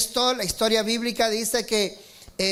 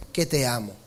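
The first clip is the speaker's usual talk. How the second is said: "Que te amo" dropped much lower, like an inner echo; lower tender echo; 7 semitones lower than usual. Acted. Peter repeating his love to the Lord, low and inward. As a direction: let "Que te amo" fall low and settle.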